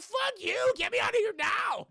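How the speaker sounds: very high, loud mock shriek; furious screech. Catherine in the pit to the agent, furious screech. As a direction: imitate an enraged captive shrieking back. Victim screams back at agent.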